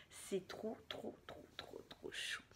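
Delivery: breathy gushing whisper. Real, not acted. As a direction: whisper it, touched. Gushing over a gift.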